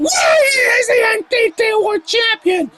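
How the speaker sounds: high, hyped commentator yell; excited shout. Real, not acted. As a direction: scream it like a huge upset. Big move in wrestling commentary.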